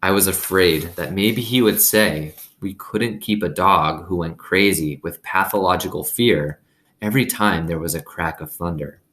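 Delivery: ordinary delivery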